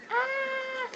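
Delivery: high, sustained "ah"; high held cry to coax a scream; demonstrated scream. Shown as a demonstration. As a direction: model a short, controlled high scream.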